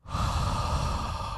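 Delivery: long unvoiced sigh of relief; reliving the moment the danger passed, long exhale; relieved exhale. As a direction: let the breath out after the tension. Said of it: "exhale a little bit".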